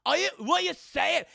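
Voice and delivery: loud, high Scottish bluster; hammed-up indignant Scot; protesting Scotsman. Scotsman refusing to move over for Jack, indignant. Retold Scotsman takes offense.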